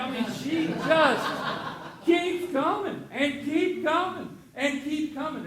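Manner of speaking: high, loud, laughing slowly through it. Real, not acted. Pastor laughing about his stubborn daughter, high and loud. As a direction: laugh it out as she keeps coming.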